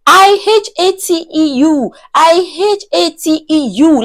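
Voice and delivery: high-pitched mock scream, spelled out; imitated screaming. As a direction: imitate her furious screaming. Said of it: "Like, she was screaming it".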